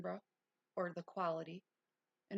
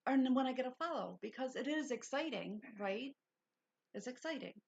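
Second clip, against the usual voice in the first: higher-pitched, lively excitement; excited; 5 semitones higher than usual. Real, not acted. Host telling viewers about getting a follow, excited. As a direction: let the excitement lift your voice. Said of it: "I do get excited".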